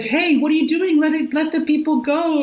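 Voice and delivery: put-on high cooing baby talk. Mocking the voice people use on dogs, high baby talk. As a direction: coo at the dog like it's an infant. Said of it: "That is the baby talk voice".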